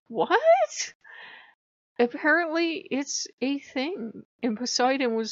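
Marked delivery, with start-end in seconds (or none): 0.1-0.9 high, startled "What?"; a much higher, shocked "What?"; astonished what. Retold or imitated. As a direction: shoot "What?" way up in disbelief. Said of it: "I'm like".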